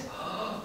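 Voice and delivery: sharp unvoiced gasp; a sudden intake of breath in shock. The king gasping as the chanting stops.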